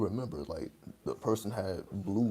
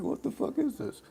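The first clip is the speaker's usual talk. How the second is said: high-pitched disbelief. Retold or imitated. Dreamer reacting to people respawning in his dream, high-pitched disbelief. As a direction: squeal it in a very high, incredulous voice.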